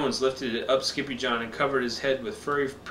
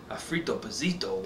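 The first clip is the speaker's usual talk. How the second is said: hushed and softer; whispered; 4 semitones lower, 6 dB quieter than usual. Acted. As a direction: breathe it out, hushed. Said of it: "whispered the crowd".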